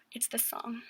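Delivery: barely audible whisper; tiny unvoiced-breath whisper, acting out the moment; hushed reaction. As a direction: whisper it, as if stopped short by the song. Retelling her stunned reaction at the song.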